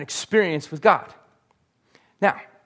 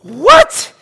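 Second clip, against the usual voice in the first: shocked shriek, very high and loud; a stunned outraged yelp; freaking out; 15 dB louder than usual. Acted. Habakkuk hearing God's plan, shocked high shout. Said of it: "he freaks out".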